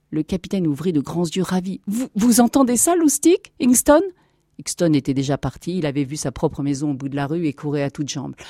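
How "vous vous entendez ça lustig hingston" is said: high, loud excited stammer; elated disbelief. Acted. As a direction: call to the men, overjoyed. Ravished captain calls his men.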